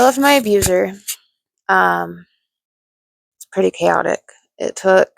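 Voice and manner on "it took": usual speaking voice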